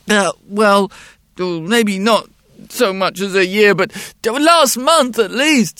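put-on high, loud, hesitant child; little boy voice. A narrator's reading. Boy's voice in story.

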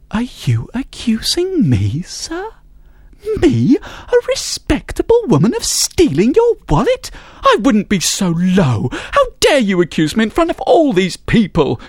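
high, haughty feigned outrage; a respectable woman affronted in public; indignant lady. Acted. Woman indignantly denies theft.

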